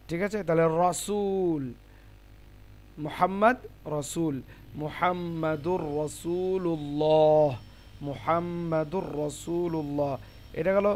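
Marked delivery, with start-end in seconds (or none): 0.8-1.6 রসুল slow, rounded "raw" and long "sool"; heavy Ra, drawn out. Shown as a demonstration. Teacher models the example, রসুল slow with heavy Ra. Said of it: "র মোটা হয়". 4.8-10.1 মুহাম্মাদুর রসুলুল্লাহ slow, rounded "raw" and long ending; heavy Ra recitation. Shown as a demonstration. Teacher gives the zabar example, মুহাম্মাদুর রসুলুল্লাহ slow with heavy Ra. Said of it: "জাবারের উদাহরণ".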